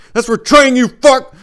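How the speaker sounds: very high, very loud snarled revenge; vengeful shout. Acted. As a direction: spit the words at the dead man. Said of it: "I said".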